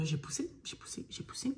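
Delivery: soft whispered repetition. Retold or imitated. Mother recalling labor to viewers, whispering. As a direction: whisper each push as it fades.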